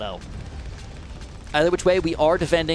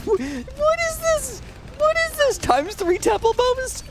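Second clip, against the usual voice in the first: very high laughing questions; laughing disbelief; slower than usual. Real, not acted. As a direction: laugh at the absurd bonus. Absurd bonus appears.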